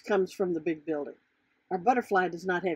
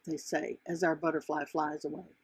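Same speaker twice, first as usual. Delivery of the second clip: ordinary delivery, nothing marked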